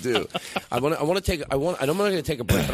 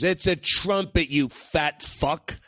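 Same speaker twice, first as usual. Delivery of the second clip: slow, raised-pitch mock indignation; retold upset outburst; 3 semitones higher, much slower than usual. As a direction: imitate an offended musician, stretching out every word. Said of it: "he got very upset".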